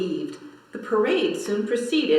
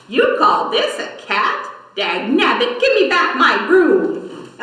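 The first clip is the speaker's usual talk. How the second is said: angry witch; 5 semitones higher, 7 dB louder than usual. Acted. Angry witch loses broom.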